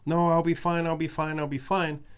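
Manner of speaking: imitating the girl. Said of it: "she's obviously going".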